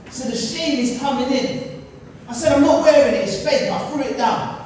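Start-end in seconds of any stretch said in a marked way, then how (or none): 2.5-3.7 refusing. Retold or imitated. Refusing to wear it.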